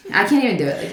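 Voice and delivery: his catchphrase voice, lightly done; imitating Caesar. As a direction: borrow his voice for a beat. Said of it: "I heard his voice come out of your".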